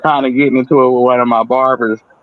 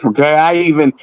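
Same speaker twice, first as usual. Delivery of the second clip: ordinary talk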